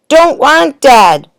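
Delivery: put-on high, loud, slow toddler bellow. A narrator's reading. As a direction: bawl it out like a small child. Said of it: "bellowed the baby".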